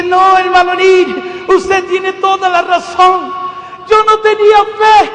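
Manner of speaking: tearful outcry. Retold or imitated. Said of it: "La hermana empieza a llorar".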